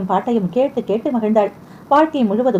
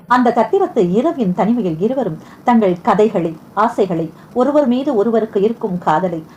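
usual speaking voice

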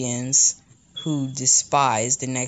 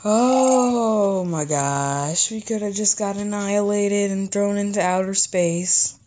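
relieved exclamation; 5 semitones higher than usual. Acted. Kid relieved after the champion leaves, high and excited. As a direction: let the relief pour out.

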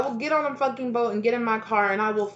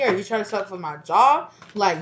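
usual speaking voice

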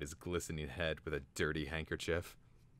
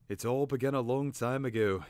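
lightly voiced, higher bird storyteller; storytelling bird; 7 semitones higher, 6 dB louder than usual. A narrator's reading. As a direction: begin the tale with some importance. Cater bird voiced in narration.

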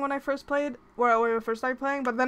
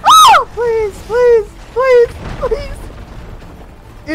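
frantic pleading shout, higher and louder; panicked begging; 11 semitones higher, 12 dB louder, much slower than usual. Real, not acted. Streamer begs the game to spare her, shouting. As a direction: beg the game to stop, terrified. Begging during a scary fight.

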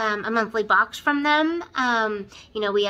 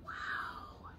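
whispered wow; 20 dB quieter than usual. Real, not acted. Admiring the palette.

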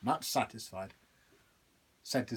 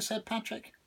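ordinary delivery

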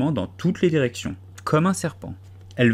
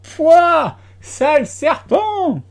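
very high, much louder, slow piercing cry; shrill shriek; 14 semitones higher, 8 dB louder, slower than usual. A narrator's reading. Pigeon shrieking at the snake, high piercing cry. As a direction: let it climb into a screech. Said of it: "la voix monta jusqu'à devenir un cri aigu".

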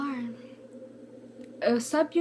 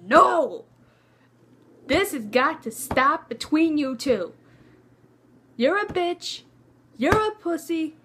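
loud, hammed-up scolding; going in hard; 5 semitones higher, 5 dB louder than usual. Robbie breaking up the couple's fight, acted loud outburst. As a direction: burst in loudly and lay into them. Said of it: "Robbie just went in".

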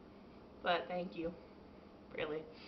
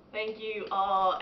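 slow, louder grateful thanks; slow heartfelt thanks; 2 semitones higher, 7 dB louder, much slower than usual. Real, not acted. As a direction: thank them slowly and sincerely.

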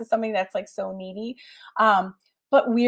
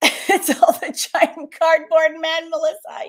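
laughing; 7 semitones higher, 4 dB louder than usual. Real, not acted. Reading viewer comment, laughing.

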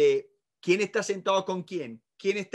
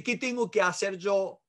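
normal talking voice

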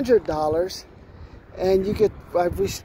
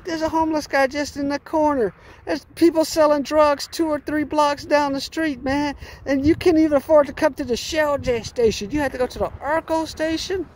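high, teasing put-on voice; ridiculing the driver's cheap gas station choice in a high voice; mocking a buddy; 6 semitones higher than usual. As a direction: rib him like a friend would. Mocking a proud Arco driver.

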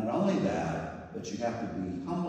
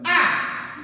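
exclamation; 10 dB louder than usual. Retold or imitated. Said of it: "And he went".

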